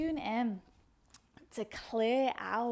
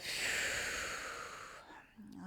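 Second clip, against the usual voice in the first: slow whispered breath out; long soft whisper sigh; 5 dB quieter than usual. Shown as a demonstration. Teacher releasing a full breath with the class, soft long sigh. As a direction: let the breath drain out softly.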